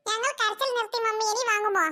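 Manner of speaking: put-on pleading little kid. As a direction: coax mummy like a small child. Kids ask mother again.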